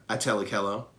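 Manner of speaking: ordinary delivery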